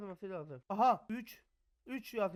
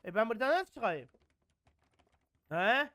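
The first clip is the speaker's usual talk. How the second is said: ordinary talk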